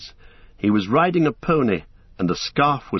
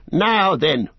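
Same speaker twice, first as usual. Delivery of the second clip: louder, firm country challenge; stern farmer; 8 semitones higher, 4 dB louder than usual. A narrator's reading. Farmer confronting a stranger on the road, firm. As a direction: halt the stranger.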